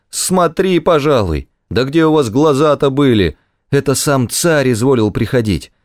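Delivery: angry scolding corporal. Acted.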